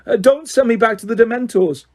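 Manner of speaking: terrified son swearing innocence; panicked pleading. A narrator's reading. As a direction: beg not to be sent back. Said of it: "shrieked the boy".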